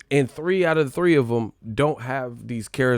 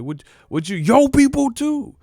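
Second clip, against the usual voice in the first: mock offended Wilder; 14 semitones higher, 6 dB louder than usual.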